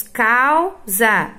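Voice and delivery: causa said slowly, syllables held; slow example word. Shown as a demonstration. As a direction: model causa slowly for pupils. (palavra dita devagar)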